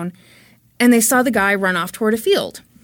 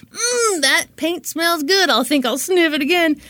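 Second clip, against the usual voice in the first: relishing paint sniffer; 7 semitones higher than usual. Pretend. Suspect enjoying the paint fumes, hammed-up relish. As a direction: hum and savor it dreamily.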